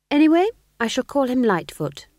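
warm defiance. A narrator's reading. Young witch names her pet despite criticism, defiantly. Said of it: "her voice warm with defiance".

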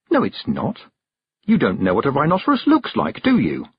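put-on high-pitched girl contradicting; cheeky challenge with a tag question; challenging. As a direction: call her bluff, voice lifted. Girl playfully challenging the old witch.

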